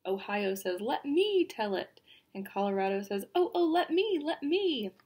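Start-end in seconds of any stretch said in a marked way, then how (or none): 0.8-2.0 a lightly acted eager plea; eager volunteer. Ohio begs to be the storyteller, eager. 3.3-4.9 high, excited plea to be picked; eager pleading. Acted. Colorado begs to tell the story, eager pleading.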